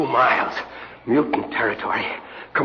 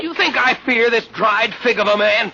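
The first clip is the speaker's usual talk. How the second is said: scornful outburst; 9 semitones higher than usual. Acted. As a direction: spit the insult at the old man. Defiant outburst at holy man.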